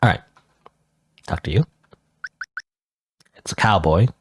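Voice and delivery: usual speaking voice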